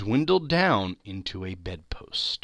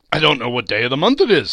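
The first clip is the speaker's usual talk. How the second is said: giddy babbling; 3 semitones higher, 8 dB louder, faster than usual. A narrator's reading. Scrooge babbling with joy.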